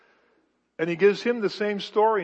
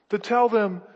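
ordinary delivery

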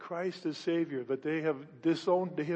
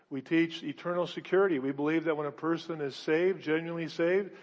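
ordinary talk

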